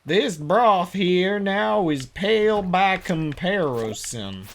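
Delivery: slow, lightly hammed-up southern drawl; overdone southern drawl. Shown as a demonstration. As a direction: try too hard at a southern accent. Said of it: "I'm trying too hard now".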